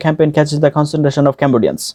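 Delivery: connected tongue-twister run without pauses; all run together. Shown as a demonstration. Teacher shows the whole thing at once, run together. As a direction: roll straight through in one even stream. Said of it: "এবার সম্পূর্ণটা একসাথে শুনুন".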